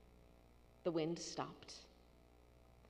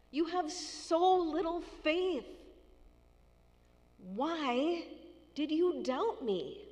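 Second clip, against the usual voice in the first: higher, louder, slow exasperated scolding; frustrated rebuke; 8 semitones higher, 4 dB louder, slower than usual. Retold or imitated. Jesus rebuking Peter, exasperated and scolding. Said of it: "maybe we heard him say to Peter".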